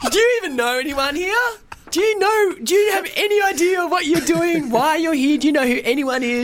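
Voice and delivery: high-pitched, ranting imitation of the bride; mock upset bride. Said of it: "She was really upset".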